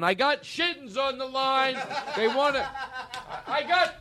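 very high, drawn-out mock excitement; high-pitched, laughing fake excitement; mock excited caller. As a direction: play someone thrilled to have a sponsor on the line. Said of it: "Someone right now is going".

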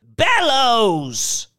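high, loud, drawn-out outcry; indignant cry. A narrator's reading. Old woman supplying the name Mister Thurkle forgot, crying out indignantly. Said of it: "she cried".

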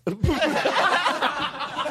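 breaks into high, open laughter; can't hold back a delighted laugh; laughing hard at the memory of the line. Real, not acted. Laughing at his own story.

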